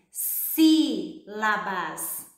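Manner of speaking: sílabas drawn out slowly, the SÍ syllable stretched and stressed; slow stressed demo. Shown as a demonstration. Teacher to child, slowly stressing sílabas. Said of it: "Observe que o som mais forte".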